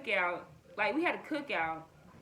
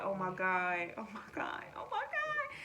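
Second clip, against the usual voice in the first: disbelief building to a squeal; shocked disbelief; 5 dB quieter than usual. Retold or imitated. Shock at cousin reveal.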